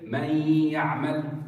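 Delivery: spend the time on the ya joining من to يعمل; lingering on the ya. Shown as a demonstration. Teacher shows students where the time goes, lingering on the ya. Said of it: "On the ya".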